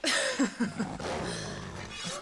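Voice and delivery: low, scornful chuckle; mocking laugh. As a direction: laugh at him with open contempt. Villainess mocks the rescuer.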